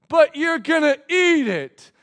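high, loud, slow mock whine; whining child. Us answering God like a child, loud mock protest. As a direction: protest like the stubborn toddler.

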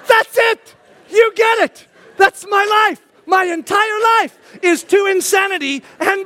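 high, loud, jubilant hollering; excited shouting. Retold or imitated. Dad joining his son's battle cry, excited shouting. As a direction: holler it like a triumphant revelation.

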